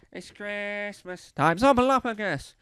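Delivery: put-on loud, slurred and drawn out like a drunk. Shown as a demonstration. Comic showing how a drunk says the Muppet's name, slurred. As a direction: slur it like a drunk singing carols. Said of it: "That's how you say Snuffleupagus as a drunk person".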